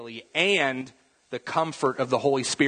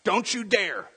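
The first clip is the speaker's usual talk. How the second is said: higher, stern mother's warning; stern mom; 6 semitones higher than usual. Retold or imitated. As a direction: warn him off like a strict mom. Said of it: "the mom peeks around the corner and is like".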